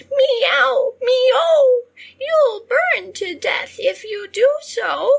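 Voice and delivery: very high, loud warning meow; cat meow. A narrator's reading. Cats warning Harriet, high loud meow. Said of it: "they began to hiss".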